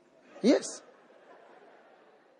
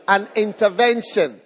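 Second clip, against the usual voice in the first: usual speaking voice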